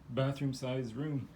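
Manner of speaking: ordinary delivery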